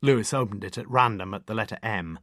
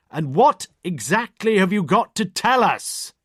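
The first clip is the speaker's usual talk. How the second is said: much higher, louder and slow, every word spelled out; bawling; 11 semitones higher, 9 dB louder, slower than usual. A narrator's reading. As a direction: bellow slowly for a deaf old lady. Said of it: "bawled Morse".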